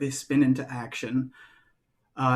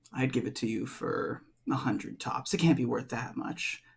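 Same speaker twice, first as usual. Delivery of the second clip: much softer, offhand haggling; soft dismissive lowball; 9 dB quieter than usual. Acted. Edelwald undercutting the offer to the player, soft and dismissive. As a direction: shrug the price off quietly. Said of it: "Edelwald walks up and goes".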